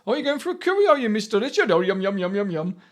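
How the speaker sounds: put-on Indian accent, mimicking someone's jokey impression; mock Indian accent. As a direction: do a hammed-up Indian accent, dropping back on the last words. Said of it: "she puts on this Indian accent".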